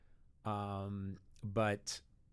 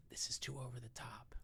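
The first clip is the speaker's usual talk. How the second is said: unvoiced, confidential aside; whispered thought; 11 dB quieter than usual. Dad judging the show beside his daughter, whispered thought. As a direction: whisper it as a private criticism.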